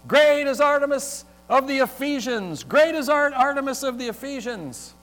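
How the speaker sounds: high, loud crowd chant; crying out. A narrator's reading. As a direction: chant it loudly as one angry crowd. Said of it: "they all cried with, out with one voice".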